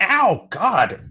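high, loud pretend yelp; hammed-up ouch; mock pain. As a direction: cry out as if just zapped. Imitating the tased victim's reaction.

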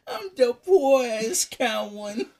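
slow, high, hammed-up sobbing; mock crying. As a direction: whine it like a fake cry. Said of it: "who hurt your feelings?".

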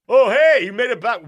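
high, loud, jolly greeting; cheerful NPC. Acted. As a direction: greet them like a cheery host.

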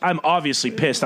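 usual speaking voice

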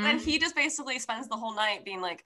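ordinary talk, nothing marked

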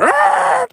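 very high mouthed brake squeal; imitated tire screech. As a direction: screech like braking tires.

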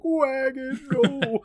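high, drawn-out mock wail; hammed-up movie-style lament; mock dramatic cry. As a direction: cry out in pretend anguish over a lost toy. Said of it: "you're just like".

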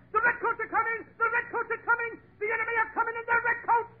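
hammed-up warning yell; hollered. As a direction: call it out like a town crier. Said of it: "the guy that hollered".

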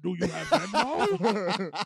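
slow, gruff puppet monster voice through laughter; Cookie Monster voice. Pretend. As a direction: drag it out like a cookie-hungry monster. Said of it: "still in Cookie Monster voice".

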